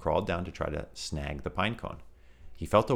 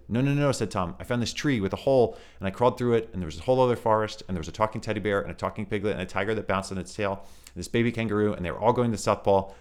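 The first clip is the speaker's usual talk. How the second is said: higher, louder, fast excited rush; 4 semitones higher, 4 dB louder, faster than usual. Acted. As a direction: blurt it all out in a rush. Tom excitedly pouring out story.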